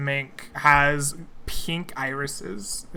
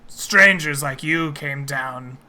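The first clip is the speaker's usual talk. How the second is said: creature voice; slower than usual. Acted. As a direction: speak slowly like a brooding predator. GM voices mink.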